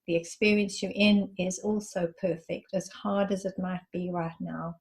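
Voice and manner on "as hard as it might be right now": usual speaking voice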